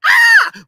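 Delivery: loud, high exclamatory "ah"; excited cry. Real, not acted. Preacher-like commentator overcome by the news, excited cry.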